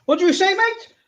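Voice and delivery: very high-pitched mock challenge; cocky retort, pitched right up; confrontational comeback. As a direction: act out squaring up to a neighbour. Retold squaring up to challenger.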